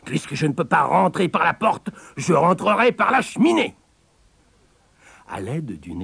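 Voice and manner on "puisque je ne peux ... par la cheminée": high wolf voice climbing higher; heated threat, rising to a high finish; exasperated wolf. Acted. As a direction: vow it as the fuming wolf.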